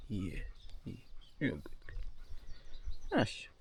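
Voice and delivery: soft, almost whispered praise; hushed praise. Real, not acted. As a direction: whisper gently to the dog.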